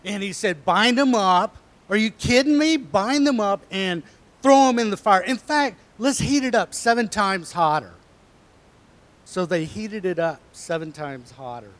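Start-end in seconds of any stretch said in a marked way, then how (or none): 0.6-7.9 loud, furious royal command; enraged king. Acted. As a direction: bellow the order like an angry king. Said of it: "he was enraged".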